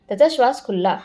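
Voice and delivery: usual speaking voice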